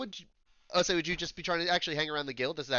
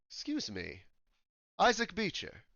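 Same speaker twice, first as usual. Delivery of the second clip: a light attempt at a British accent; older elf with tentative British accent; 6 semitones higher than usual. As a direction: try a polite British voice. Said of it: "I'm trying to do British".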